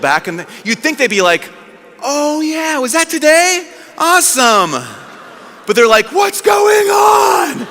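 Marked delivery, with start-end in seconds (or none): 2.0-4.7 high, breezy mock delight; pleased realisation. Disciples imagined remembering the rising, airy mock delight. 6.1-7.7 high, drawn-out comic shout; freaking out. Retold or imitated. Disciples shocked when it all happens, freaking-out shout. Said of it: "they freak out".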